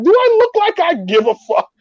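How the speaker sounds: high-pitched, indignant comeback; defiant retort. Retold or imitated. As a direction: act out a defiant squawk at your friends. Acting out his defiant shout back.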